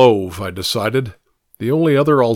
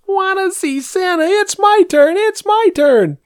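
put-on high, loud whiny child voice; crying little girl; 4 dB louder than usual. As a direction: wail like a toddler wanting her turn. Little girl crying for her turn.

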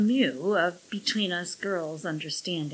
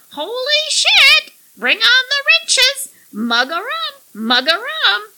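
high, loud squawking parrot chatter; shrill bird repeating its phrases; 14 dB louder than usual. A narrator's reading. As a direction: screech every phrase like a pirate's parrot. Parrot shouts.

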